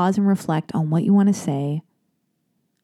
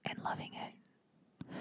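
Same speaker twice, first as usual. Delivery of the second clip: trailing breathy whisper; 24 dB quieter, much slower than usual. Real, not acted. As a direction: whisper it to yourself. Aside while returning to the email.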